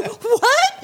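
high-pitched, laughing disbelief; shocked. Retold or imitated. Acting out her shocked reaction.

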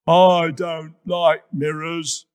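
slow, loud shout. A narrator's reading. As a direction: shout it out slowly. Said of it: "shouted Hippo".

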